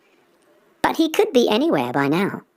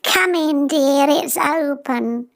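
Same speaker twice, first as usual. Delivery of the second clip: put-on high, slow, kindly old lady; old grandmother; 9 semitones higher, much slower than usual. Grandmother calling to her visitor, high and kindly. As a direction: invite them in like a sweet granny.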